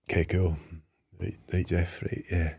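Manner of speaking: soft, numb acknowledgement; muted shock. Retold or imitated. Patient answering Jeff after the diagnosis, quietly stunned. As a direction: trail along quietly, barely taking it in. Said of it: "I think I probably was in shock".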